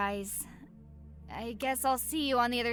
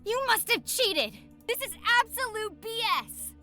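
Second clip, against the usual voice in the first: fast, high, furious accusation; angry shouting; 13 semitones higher than usual. Acted. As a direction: yell like a sore loser. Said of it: "started getting extremely aggressive".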